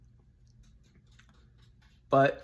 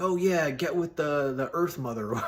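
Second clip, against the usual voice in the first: higher, louder put-on voice of an ancient clansman; 5 semitones higher, 4 dB louder than usual. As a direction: ham it up like a hippie tribesman. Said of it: "and they'd be like".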